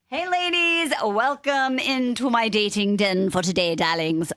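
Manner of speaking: put-on British hostess welcome; grand British "darlings" greeting; British accent. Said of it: "just did a Brit-, British accent".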